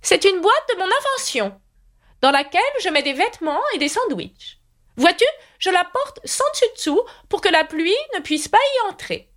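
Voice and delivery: high, proud inventor's voice. A narrator's reading. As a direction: show off the invention, high and eager. Knight explains invention.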